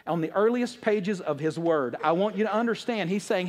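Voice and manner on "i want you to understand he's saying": ordinary talk, nothing marked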